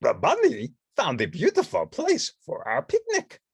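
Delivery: high, loud-ish furry monster voice; Grover voice. Acted. Grover admiring the picnic spot, high. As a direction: cheerfully praise the bunny as Grover.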